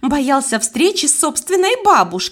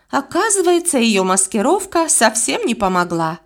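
ordinary delivery

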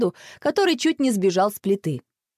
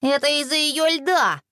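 put-on higher, childish finger-pointing voice; tattling twins; accusing; 6 semitones higher than usual. A narrator's reading. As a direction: pin the blame in a high kid's voice. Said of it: "они указали на Василису".

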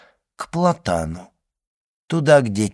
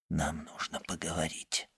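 tense urgent whisper, much softer; 6 semitones lower, 16 dB quieter than usual. Acted. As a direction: whisper it so no one hears. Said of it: "шепнул госпоже Данглар".

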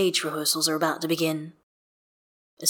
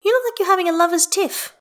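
high, louder character voice; teasing friend; 13 semitones higher, 5 dB louder than usual. Acted. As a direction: tease them in a high, perky voice. Isabel teases the two men.

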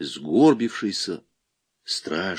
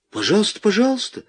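high, eager rising invitation; over-obliging and a little flustered; flustered hospitality; 12 semitones higher, faster than usual. A narrator's reading. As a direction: usher them in, pitch climbing on the repeat. Flustered host admitting police officer.

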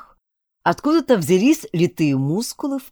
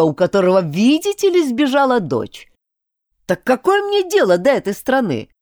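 angry rant; 6 semitones higher, 6 dB louder than usual. Acted. Prince's bitter angry rant.

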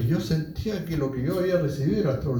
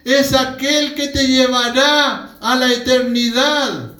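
loud, slow, rising sermon climax; ringing declaration, drawn out and building higher; impassioned preaching; 6 semitones higher, 9 dB louder, slower than usual. Real, not acted. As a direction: proclaim each phrase at full force. Preaches at full force.

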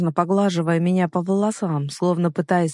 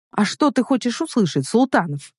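higher-pitched challenge; defiant retort; 5 semitones higher than usual. A narrator's reading. Heroine answering the man's demand to speak, defiant. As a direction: throw the question back in defiance. Heroine snaps back in quarrel.

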